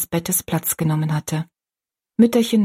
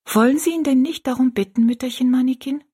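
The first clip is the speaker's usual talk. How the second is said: put-on child's simple question; 6 semitones higher than usual. A narrator's reading. Rosalie simply asks.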